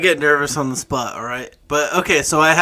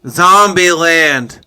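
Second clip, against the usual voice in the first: very slow, louder, drawn out; talking to hard of hearing; 7 dB louder, much slower than usual. Real, not acted. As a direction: stretch every syllable for a deaf ear.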